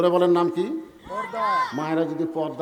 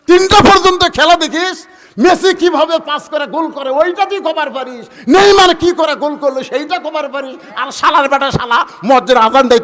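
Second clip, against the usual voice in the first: loud, raised tirade at a lazy son; angry father scolding; 8 semitones higher, 10 dB louder than usual. Acted. Father scolds son for watching football till three, furious tirade. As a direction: rail at him, high and loud.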